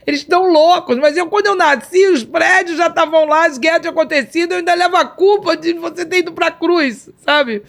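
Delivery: high, loud, fast outpouring; overwhelmed outpouring. Retold or imitated. Retelling how she blurted everything out to Jesus, high and loud. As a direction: spill it all out in a rush, nearly shouting.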